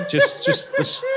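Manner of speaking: very high shouted dummy voice. Pretend. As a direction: squeal it like a creepy puppet. Ventriloquist dummy voice bit.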